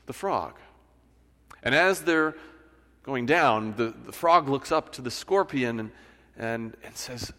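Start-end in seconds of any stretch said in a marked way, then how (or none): none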